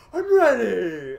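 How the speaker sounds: loud, drawn-out battle cry; mock eager shout. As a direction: yell it slowly like a hero charging in. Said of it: "and you're just like".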